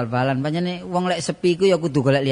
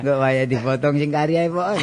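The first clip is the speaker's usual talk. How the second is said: usual speaking voice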